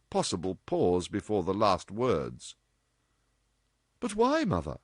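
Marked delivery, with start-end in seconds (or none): 4.0-4.8 put-on higher insistent girl's question; persistent. A narrator's reading. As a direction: press the point. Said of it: "persisted Dorothy".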